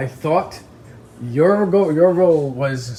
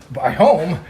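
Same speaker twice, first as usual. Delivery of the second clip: normal talking voice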